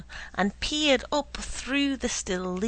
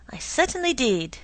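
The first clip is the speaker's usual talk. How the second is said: louder, stubborn insistence; insistent scowl; 4 semitones higher, 6 dB louder than usual. A narrator's reading. Doodle scowls at his brother and insists, louder. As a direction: dig in your heels, scowling. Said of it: "Doodle reiterated, scowling at me".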